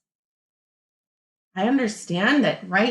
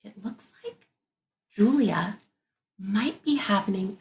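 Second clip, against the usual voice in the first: ordinary delivery, nothing marked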